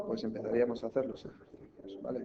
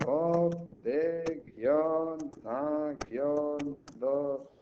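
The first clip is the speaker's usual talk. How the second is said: each syllable held and set apart, slightly raised pitch; 2 semitones higher, much slower than usual. Shown as a demonstration. Teacher showing pupils how to split the word, syllable by syllable. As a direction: dictate it in separate syllables, hyphens spoken aloud. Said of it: "cómo separo yo esto en sílabas".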